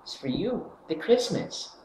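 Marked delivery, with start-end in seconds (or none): none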